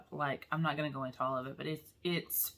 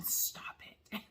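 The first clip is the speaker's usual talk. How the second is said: imitated whispered plea; 7 dB quieter than usual. Officers begging her not to cry, whispered. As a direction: hiss it under the breath. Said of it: "officers looking at me going".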